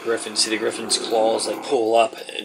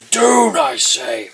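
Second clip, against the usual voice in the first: high, drawn-out half-orc shout; loud shout; 12 semitones higher, 6 dB louder, much slower than usual. Acted. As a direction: shout it insistently, slow and high. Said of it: "as he shouts loud".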